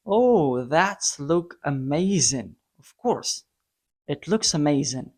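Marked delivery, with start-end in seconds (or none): none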